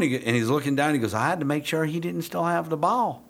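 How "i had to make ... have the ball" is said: high-pitched Southern handler imitation; Southern accent. Handler explaining himself to the trainer, Southern accent. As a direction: put on an earnest Southern twang. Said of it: "a Southern accent that's gonna be pretty sweet".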